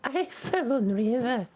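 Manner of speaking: put-on very slow, halting old seer; drawn-out cryptic directions, pausing often; mysterious old grandmother.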